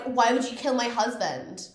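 high, loud mock protest; high-pitched mock outrage; retold indignant retort. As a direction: fire back as the aggrieved sister. Retold retort in sibling quarrel.

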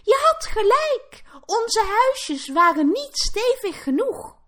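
high-pitched piglet wail; pleading. A narrator's reading. Piglets admit to brother he was right, pleading. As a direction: squeal the admission, pitched far up. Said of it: "smeekten ze".

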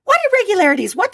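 mock innocent outrage; indignant shock. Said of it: "you'd be like".

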